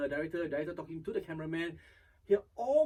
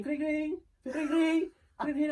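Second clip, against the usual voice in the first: high-pitched mimicked ringing phone; imitated phone ring; 11 semitones higher, 6 dB louder than usual. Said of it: "there's a phone ringing".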